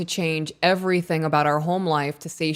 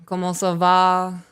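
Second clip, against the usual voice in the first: French phrase; 2 semitones higher, 3 dB louder, slower than usual. Shown as a demonstration. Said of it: "Do you actually speak French".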